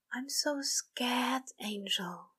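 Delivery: slow, scared whisper, very soft; broken whisper. A narrator's reading. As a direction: confess fear in a cracked whisper. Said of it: "the demon's whisper, the way his voice broke".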